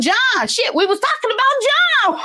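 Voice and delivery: high-pitched exasperated outburst; mock exasperation. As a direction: squawk it as if it were obvious. Acts out exasperated clarification to listener.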